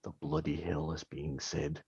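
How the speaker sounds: low, hushed dwarf aside; quiet, deep, puzzled grumble; Bragg. Acted. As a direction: lean in and mutter it slowly. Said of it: "lean to Amaris and like".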